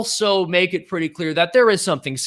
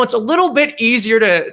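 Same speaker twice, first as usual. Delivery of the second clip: ordinary talk, nothing marked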